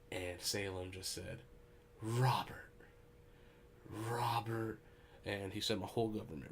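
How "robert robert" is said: whispered name, hanging in disbelief; mock-appalled whisper. Friend confronting his stoned buddy, in an appalled whisper. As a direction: imitate a friend whispering in dismay.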